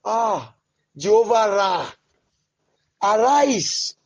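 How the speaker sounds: ordinary talk, nothing marked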